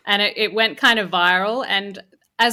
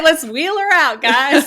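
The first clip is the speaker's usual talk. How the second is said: bright, louder mock announcement of a token woman; mock cheery boss; 5 semitones higher, 5 dB louder than usual. Veteran mimics commanders parading her out, in a mock cheery voice. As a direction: play the boss showing off the only woman. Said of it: "It's like".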